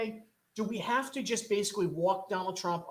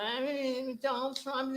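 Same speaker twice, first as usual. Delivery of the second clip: higher, slurred mock Pelosi voice; drunk Nancy Pelosi; 7 semitones higher, slower than usual. Host mocking Nancy Pelosi, slurred drunk impression. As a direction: slur it like a tipsy politician. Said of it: "the way she talks like she's all drunk".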